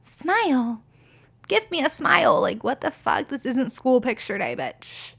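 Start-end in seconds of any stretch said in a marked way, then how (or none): 0.0-2.4 higher, mocking smile chant; hammed-up leering men; mock catcaller. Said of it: "they can be like".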